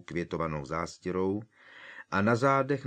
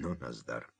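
low, much softer groan of dismay; dismayed sigh; 7 semitones lower, 9 dB quieter than usual. A narrator's reading. Hermione sighs after Hagrid slams the door, low. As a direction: let it out under your breath. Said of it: "ulevila si Hermiona".

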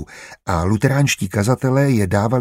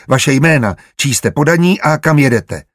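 raised, higher voice of a guard; demanding interrogation; 5 semitones higher, 5 dB louder than usual. A narrator's reading. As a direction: demand answers from stopped travellers.

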